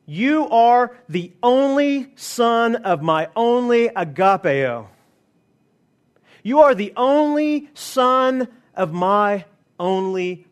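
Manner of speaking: slow, lifted declaration of God's words; the preacher voices God speaking from heaven, slow and solemn; solemn proclamation. Acted. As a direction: proclaim it slowly, each word weighed. Voicing God's declaration to Jesus.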